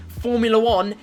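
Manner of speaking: loud, high, slow declaration; exasperated yell, each word hammered; emphatic shout. Real, not acted. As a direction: belt it out to end the argument. Rant hammering home the point.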